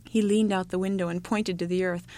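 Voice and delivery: plain reading voice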